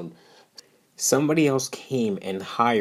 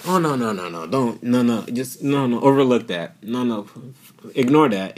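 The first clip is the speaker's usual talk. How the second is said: higher, fussy, hushing voice; hammed-up media hushing it all up; mocking the media; 4 semitones higher than usual. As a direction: shoo the scandal away in mock panic. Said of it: "the media just acts like".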